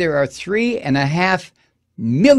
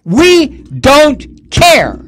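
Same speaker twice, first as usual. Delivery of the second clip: loud, very slow, drawn-out mock sneer; mock mocking yell; 10 semitones higher, 8 dB louder, much slower than usual. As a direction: bellow the indifference, stretching every word. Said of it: "legislators in Florida said".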